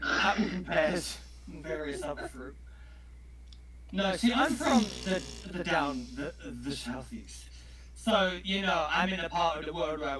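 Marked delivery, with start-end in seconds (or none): none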